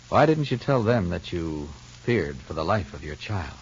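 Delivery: normal talking voice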